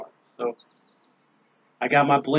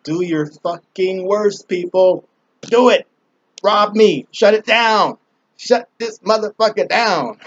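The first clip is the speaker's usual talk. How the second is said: louder, higher, daring them; defiant taunt; 6 semitones higher, 6 dB louder, slower than usual. Acted. Daring robbers and channel takedowns, loud defiant taunt. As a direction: taunt them to do their worst.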